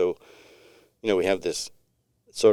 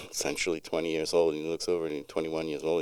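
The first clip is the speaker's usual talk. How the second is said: ordinary delivery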